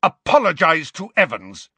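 loud, high-pitched angry demand; roar. A narrator's reading. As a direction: roar an order at him. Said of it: "James roared at Snape".

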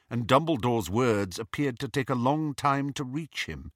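ordinary delivery, nothing marked

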